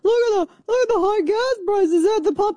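very high-pitched mocking screech; Marjorie Taylor Greene. Radio host mimicking a video about gas prices, high mocking caricature. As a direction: send up a complainer in a shrieky caricature. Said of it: "She did this video".

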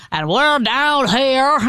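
slow, drawn-out Deep South drawl; mock a Civil War movie Southerner's accent; hammed-up Deep South accent, very slow; heavy Deep South accent. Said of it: "the heavy Deep South accent".

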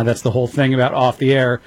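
normal talking voice

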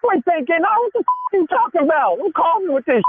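indignant, high-pitched comeback; high, rattled voice. Real, not acted. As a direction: push back angrily with the voice up high. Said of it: "Why your voice getting high?".

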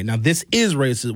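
normal talking voice

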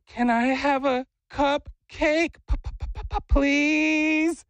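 high voice, stuttered popping "please"; coaxing child's plea. Retold or imitated. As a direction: demonstrate a cutesy begging kid voice. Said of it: "What you're gonna say is".

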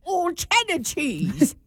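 a hint of drawn-out British; mock British accent. Joining in on the hospital joke, mock British accent.

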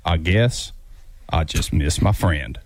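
deep narrator voice; Morgan Freeman impression. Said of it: "you need to get quieter and deeper".

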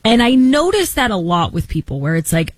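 usual speaking voice

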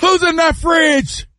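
high, slow mock shout; imitated suspicious dad. Dad guarding his food in the fridge, high mock shout. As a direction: drag out the high challenge.

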